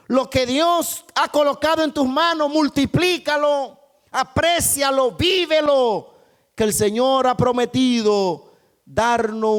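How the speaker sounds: high, loud storytelling from the pulpit; full-force preaching. Acted. Pastor telling the congregation the twins story, preaching loudly. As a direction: drive the story home to the church.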